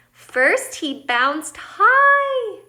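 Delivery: HIGH soars up, long and loud; slow build to a high, drawn-out HIGH; high bounce. A narrator's reading. Acts out the word high.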